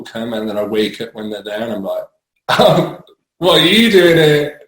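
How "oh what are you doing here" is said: higher, louder innocent surprise; mock surprise. Waking up drunk to paramedics, mock surprised. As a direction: play dumb, startled and chirpy.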